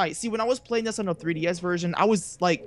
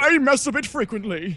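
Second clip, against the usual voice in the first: louder, higher silly voice; put-on character voice; 5 semitones higher, 5 dB louder than usual. Joking about the fisherman, loud silly voice. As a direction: blurt it out in a put-on voice.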